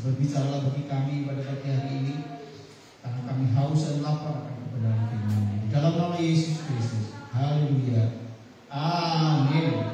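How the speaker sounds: loud, rising fervent prayer close. Acted. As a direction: build the prayer to a loud amen. Prayer climax before amen.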